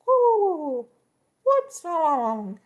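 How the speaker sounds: very high, loud cooing, slow; flustered cooing birds; pigeon voices. Acted. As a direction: coo like fluttering pigeons. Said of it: "Now we're doing pigeons".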